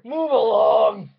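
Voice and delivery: comic guard roaring her away; mock yelling guard. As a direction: bellow at her to clear off, over the top. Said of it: "just with a far end of spear".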